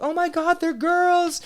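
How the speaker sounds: very high squealed exclamation; imitated high-pitched glee; excited crowd reaction. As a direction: mimic everyone squealing with delight. Acting out everyone's excited reaction.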